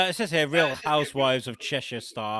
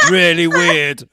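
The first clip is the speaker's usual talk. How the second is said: loud, higher mock Liverpudlian; Cheshire accent; 4 semitones higher, 15 dB louder, slower than usual. Quoting the reality star to co-host, laughing Cheshire accent. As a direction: belt it in a Cheshire twang while laughing. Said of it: "I was gonna say a bit Liverpudlian".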